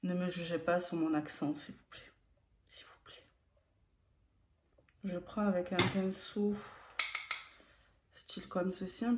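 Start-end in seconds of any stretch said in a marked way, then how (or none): 1.5-3.2 hushed, voiceless whisper; whispered plea. Real, not acted. Asking viewers not to judge the accent, whispered plea. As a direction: whisper it as a little aside plea.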